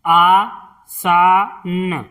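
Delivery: आसान drawn out slowly as आ-सा-न; slow syllable-by-syllable. Shown as a demonstration. Teacher sounding out आसान for pupils, slowly. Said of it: "इस तरह से".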